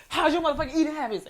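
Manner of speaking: high, fast confrontational quote; in-your-face mock aggression. Imitating her date mocking her text, in-your-face aggression. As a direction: imitate someone getting right in your face. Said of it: "he got right in my face".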